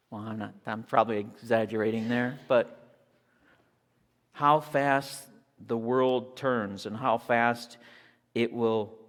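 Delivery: normal talking voice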